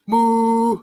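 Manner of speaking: long drawn-out moo; cow moo. Pretend. Reading a farm animal book to children, playful cow moo.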